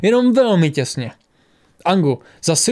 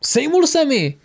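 much higher excited exclamation; pumped-up cry; 11 semitones higher, slower than usual. A narrator's reading. Gunner crowing after his shot, pumped-up cry. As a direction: cry out, buzzing with adrenaline. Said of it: "vykřikl nabuzený Ang".

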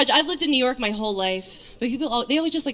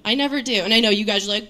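normal talking voice

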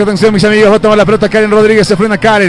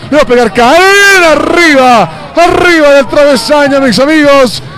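excited commentary; 4 semitones higher, slower than usual. Real, not acted. Near miss over the crossbar.

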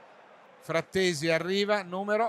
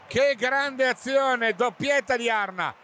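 excited; 7 semitones higher, 3 dB louder than usual. Real, not acted. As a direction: repeat it, still thrilled. Repeated exclamation after goal. (esclamazione entusiasta ripetuta)

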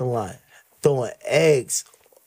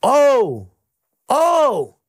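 high, loud exclamation of surprise; mock surprise; 7 semitones higher, 4 dB louder than usual. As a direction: act startled and thrilled. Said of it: "and I'm like".

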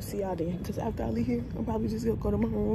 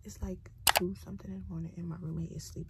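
quiet at night; 7 dB quieter, faster than usual. Real, not acted. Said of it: "so I'm trying to be quiet".

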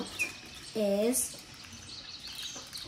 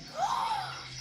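surprised gasp; 7 dB quieter than usual. Real, not acted. Finds something inside the puppet.

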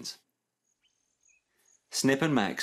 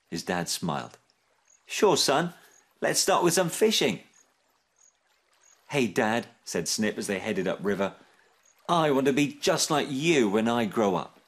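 animated storytelling with lively character voices; children's story reading; 3 semitones higher than usual. A narrator's reading. Narrator telling children about Snip's dad, animated storytelling. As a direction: read a picture book aloud with expressive swoops. Reads a picture book to children.

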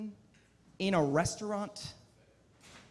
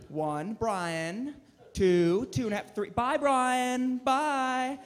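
impatient parent counting; 5 semitones higher, 4 dB louder, much slower than usual. Retold or imitated. Imitating New York parent counting.

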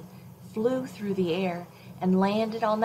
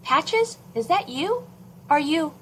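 high, louder call across the yards; calling out to the dog; 9 semitones higher, 4 dB louder than usual. Acted. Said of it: "called her next-door neighbor's dog".

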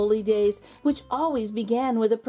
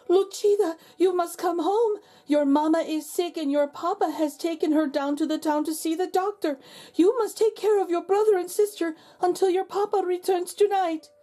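urgent neighbor woman; 9 semitones higher than usual. Acted. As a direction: rush in and warn the girl, voice pitched high. Senora Gomez rushes in with news.

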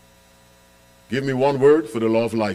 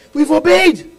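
triumphant preaching cry; 6 dB louder than usual. Real, not acted. Preacher drives home the answer.

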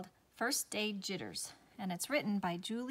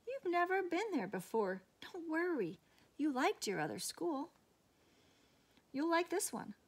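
much higher, gentle reassurance; reassuring father; 9 semitones higher than usual. A narrator's reading. Father reassuring Sarah about new school, high and gentle. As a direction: soothe her worries like a kind dad.

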